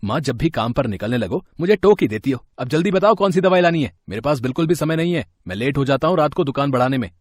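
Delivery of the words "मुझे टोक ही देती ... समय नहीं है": louder, impatient snapping at his mother; irritated son. Acted. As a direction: snap at her, no time to waste. (माँ पर झुंझलाकर ऊँची आवाज़ में)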